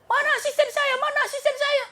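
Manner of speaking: very high calling voice; anxious king. Acted. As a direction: call out urgently for your assistant. King looks for servant.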